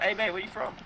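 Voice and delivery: mock English accent. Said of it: "your English accent".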